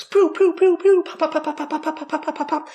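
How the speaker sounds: high, loud mock firework pops; fireworks popping. Monkey's fireworks going off, imitated with high pops. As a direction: pop it out like bursting fireworks.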